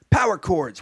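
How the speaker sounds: loud, high show-off yell; hammed-up rock announcement; excited shout. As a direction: shout it like a stoked kid showing Dad. Kid shows off to dad.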